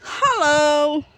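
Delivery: high, very loud drawn-out hello; long, ringing shout of greeting; calling out. Real, not acted. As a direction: holler hello across a field. Calling out across the farm again.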